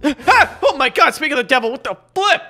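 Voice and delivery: high, loud shriek of fright; startled. Acted. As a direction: jump out of your skin, yelping. Startled by Timmy sneaking up.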